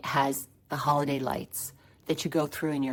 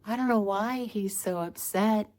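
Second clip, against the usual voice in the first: higher-pitched feigned puzzlement; imitating innocent child; 6 semitones higher than usual. Niece to her aunt about her brother, mock-innocent child voice. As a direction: act the little girl who did nothing. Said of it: "Aria would look at me and go".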